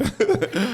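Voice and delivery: sudden high laugh; high burst of laughter. Real, not acted. Laugh alone on line.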